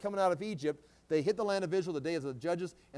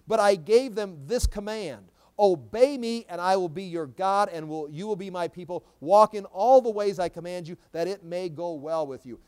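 loud, raised-pitch Bible proclamation; forceful scripture reading; 7 dB louder than usual. Acted. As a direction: proclaim the verse loudly to the congregation. Reads scripture aloud to congregation.

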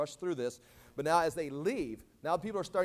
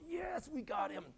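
high, soft gloating aside; secret glee; 8 semitones higher, 8 dB quieter, slower than usual. Acted. High priest inside, having caught Jesus, secret glee. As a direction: celebrate inwardly, keeping it hushed. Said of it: "actually inside he's going".

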